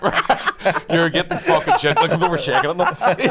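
high, loud laughing talk. Real, not acted. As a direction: say it while cracking up. Laughing retelling the attendant.

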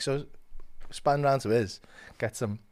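normal talking voice

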